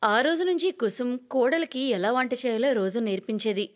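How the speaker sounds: ordinary delivery, nothing marked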